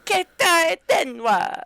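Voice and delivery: high, loud, drawn-out squeal of the words; hyped character impression. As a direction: imitate an excited girl showing off, stretching each syllable. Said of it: "She's, like, so hype about it".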